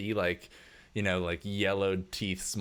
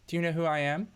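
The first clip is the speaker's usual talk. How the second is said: lightly voiced snooty socialite, much higher; haughty rich lady; 10 semitones higher, faster than usual. Rich wife answering a worker's tap, haughty and higher. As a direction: look down your nose, sweetly condescending.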